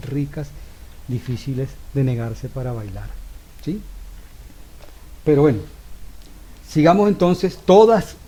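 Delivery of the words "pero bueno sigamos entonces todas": louder and higher, picking the class back up; louder restart. Real, not acted. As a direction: pull the room back to attention. Retoma la clase en voz alta. (retomando la clase, más alto y más fuerte)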